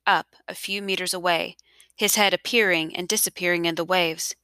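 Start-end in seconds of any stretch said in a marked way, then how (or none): none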